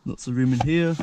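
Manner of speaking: ordinary delivery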